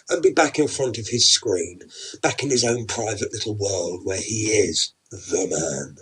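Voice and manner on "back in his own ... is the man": usual speaking voice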